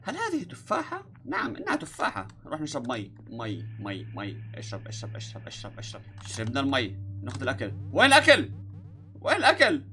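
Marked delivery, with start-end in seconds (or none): 0.0-2.3 high, soft formal Arabic like a cartoon; formal squeaky voice. He asks the game about the apple in a high, stiff formal voice. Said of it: "بس بحكي". 4.5-6.0 whispered, softer coaxing; whispered drink. Acted. Telling himself to drink the water, whispered. 7.9-9.8 loud call. Acted. Searching for food.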